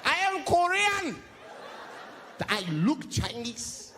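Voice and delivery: put-on high, slow indignant Korean accent; hammed-up Korean accent, offended; mock Korean accent. Comic imitation of an offended Korean man, high Korean accent. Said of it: "they will flip out".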